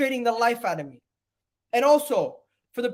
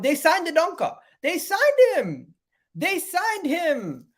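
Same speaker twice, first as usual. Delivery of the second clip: much higher, slow, stunned; disbelief; 8 semitones higher, slower than usual. Real, not acted. Shocked fan reacting to Villa's signing, high and slow. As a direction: let it sink in slowly.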